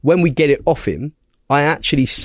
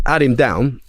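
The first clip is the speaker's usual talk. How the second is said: ordinary talk, nothing marked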